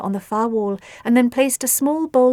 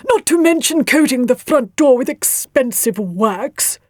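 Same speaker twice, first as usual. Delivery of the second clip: slow, louder grumbling character voice; 3 semitones higher, 5 dB louder than usual. A narrator's reading. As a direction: grumble it out slowly as an aggrieved father figure. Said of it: "grumbled Leafmould".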